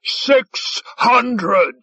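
high-pitched bidding holler; high, hammed-up call; theatrical holler. A narrator's reading. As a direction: holler the bid to top a rival. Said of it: "Five Tide hollered".

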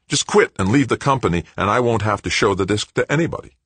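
high, pressing ultimatum; pushy demand. Acted. Blackmailing son to stepfather, pushing hard. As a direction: lay out the terms fast and pushy. Greg pushes his demand.

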